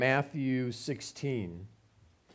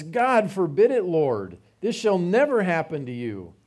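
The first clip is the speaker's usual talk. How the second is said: raised, louder pleading protest; Peter protesting; 4 semitones higher, 6 dB louder than usual. Retold or imitated. Preacher voicing Peter rebuking Jesus, alarmed protest. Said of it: "And he says".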